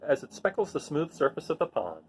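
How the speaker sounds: put-on gentle storybook reading voice for children; read-aloud narration. A narrator's reading. Teacher reading a rain picture book to her students, gentle storytelling voice. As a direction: read it like story time to a class.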